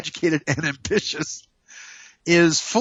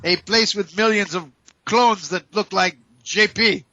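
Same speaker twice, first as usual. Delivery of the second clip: grand, higher showman voice; announcer intro; 3 semitones higher than usual. Acted. Host introduces himself as the grand showman. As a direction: hype yourself up like a game show host.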